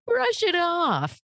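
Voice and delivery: high, drawn-out mock-glib call; breezy dismissal. Mimicking glib advice to trauma sufferers, breezily dismissive. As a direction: stretch it out high, waving trouble away.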